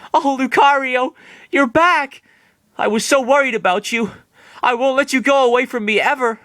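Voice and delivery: a happy, high-pitched Maylene; high girlish Maylene voice. Acted. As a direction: squeal with relief over her lost Lucario. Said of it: "she said, smiling".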